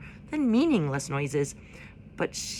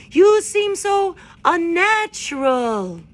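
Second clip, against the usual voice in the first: much higher, louder, slow screech; screeching owl; 12 semitones higher, 9 dB louder, much slower than usual. A narrator's reading. Owl recoiling from the robot, higher, louder, slow. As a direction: drag it out in disgust.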